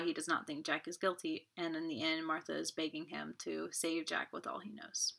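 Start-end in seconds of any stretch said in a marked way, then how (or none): 2.3-5.1 soft whispered words; whispered recap. Real, not acted. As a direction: hush it down. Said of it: "whispered summary".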